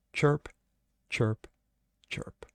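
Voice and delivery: chirps fading to nothing; trailing cricket noise for awkward silence; mock cricket chirps. Host mimicking crickets, fading away. Imitating crickets after the quote.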